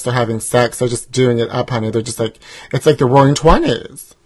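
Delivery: normal talking voice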